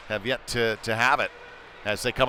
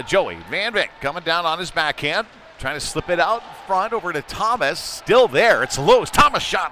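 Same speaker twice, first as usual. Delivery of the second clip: rising play-by-play, getting louder as the chance builds; excited call; 6 semitones higher, 6 dB louder than usual. Real, not acted. Announcer calling a loose puck, rising excitement. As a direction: call the scramble in front with building urgency.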